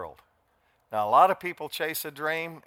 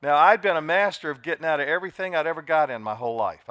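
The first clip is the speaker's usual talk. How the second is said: normal talking voice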